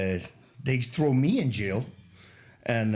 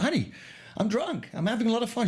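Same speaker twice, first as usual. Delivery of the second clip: pitched up, tipsy and giddy; giddy drunk voice; 9 semitones higher than usual. Retold or imitated. To his wife, a lightly played tipsy giddy voice. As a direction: play your happily drunk self on the phone.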